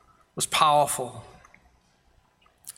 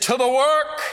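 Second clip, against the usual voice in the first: put-on a high, loud old man's rallying call; rousing old man's cry; 9 semitones higher, 4 dB louder than usual. Old Fred rallies the young pastor to go serve, high and loud. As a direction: pump your fist and send everyone off to the work. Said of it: "he pumped his fist and he said".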